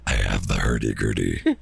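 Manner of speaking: very low, playful mock bass. Playing along with friend's hurdy-gurdy bit, bass voice. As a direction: sink into a silly, slow bass register. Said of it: "that was my bass voice".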